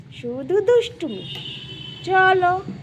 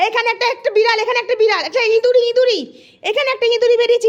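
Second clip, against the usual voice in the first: high, eager alert to a pet; excited pet-teasing; 7 semitones higher, 4 dB louder, faster than usual. Pretend. Owner telling the dog a mouse is out, excited. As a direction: get the dog worked up, pitched high.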